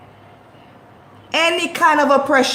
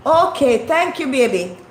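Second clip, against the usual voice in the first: ordinary talk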